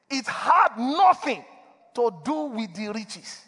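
slowed right down, leaning on nothing; slow emphatic repeat. Real, not acted. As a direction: drive the point home word by word. Preacher repeats point, slower.

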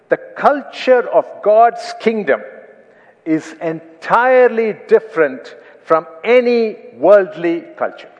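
loud, slow, proclaiming each word; forceful reading. Acted. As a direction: boom out the quotation.

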